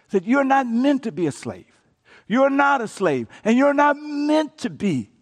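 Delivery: preacher gathering steam; rising preaching. Acted. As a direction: drive the point home with urgency. Building sermon climax.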